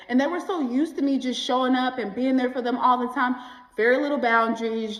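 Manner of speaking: ordinary delivery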